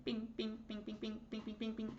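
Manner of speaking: high, soft, ringing bings; light rapid-fire pings in a small high voice; smashing sound effect. Retold or imitated. Imitates serums being smashed.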